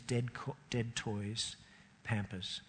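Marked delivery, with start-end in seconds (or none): none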